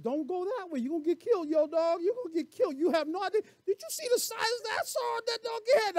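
high, urgent donkey voice warning its rider; talking donkey. Acted. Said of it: "The donkey turns aside like".

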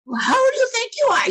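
loud, high mock indignant retort; retold retort. Young lady snapping back at the preacher, loud high mock retort.

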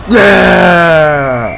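excited shout. Real, not acted. Goal celebration shout.

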